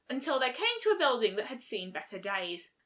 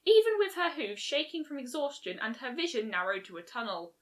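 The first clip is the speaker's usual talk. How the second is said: ordinary delivery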